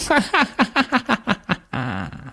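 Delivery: high, rapid cackle; witch's cackle. A narrator's reading. Witch vanishes after giving orders, cackling.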